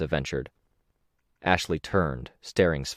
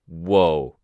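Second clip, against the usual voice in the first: a louder, drawn-out warning "Whoa"; emphatic whoa; 5 dB louder than usual. Acted. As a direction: lean hard on "Whoa" to stop her. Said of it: "with emphasis".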